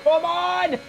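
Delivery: high-pitched cheering commentary; excited play-by-play. Real, not acted. Commentator willing Crocken on for the win, excited shouts.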